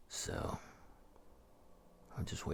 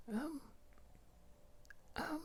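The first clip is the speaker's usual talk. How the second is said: sheepish woman; 3 dB quieter than usual. Retold or imitated. Woman admits insurance lapsed.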